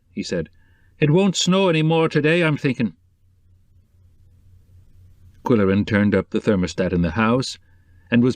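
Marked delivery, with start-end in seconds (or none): 1.0-2.9 lightly voiced Irish houseman, higher; a hint of a cheerful Irish handyman. Odell continues speaking.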